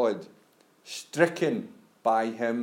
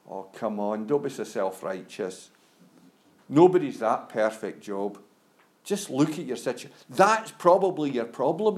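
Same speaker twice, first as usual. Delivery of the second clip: put-on Scottish accent; 2 semitones lower than usual. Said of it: "I put a Scottish accent on for those comforters".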